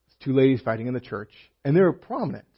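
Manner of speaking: ordinary talk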